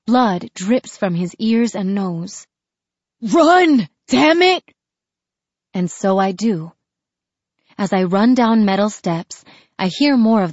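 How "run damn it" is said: high strained yell; desperate shout. Acted. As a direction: scream it through pain. Dying brother urges escape.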